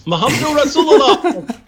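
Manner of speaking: Muhammad voice. Retold or imitated. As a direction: intone it like a prophet. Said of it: "And he goes".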